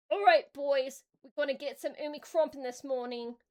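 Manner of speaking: loud rallying yell to the troops. Acted. Warboss yelling to his orc boys, loud rally. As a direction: call the boys to battle at full volume. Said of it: "Warbus Krug yelled".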